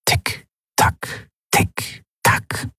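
hushed, toneless clock ticks; ticking clock. A narrator's reading. Clock counting down his remaining time, whispered ticking. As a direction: tick like a clock, spaced and unvoiced. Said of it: "Das Ticken der Standuhr".

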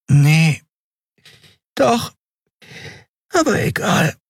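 change your answer half asleep; drowsy Nelen. Acted. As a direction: Nelen admits she was woken, drowsy.

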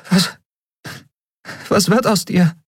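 worried woman. A narrator's reading.

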